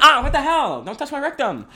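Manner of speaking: high, loud startled cry going into a laugh; hammed-up outraged squeal; mock yelp. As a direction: yelp like someone just got poked. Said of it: "and I went".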